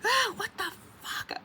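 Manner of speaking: high shriek, then a tight curse; disgusted shriek. Retold or imitated. Seeing slime on her seat, a high disgusted shriek. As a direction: shriek at the mess. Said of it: "I'm like".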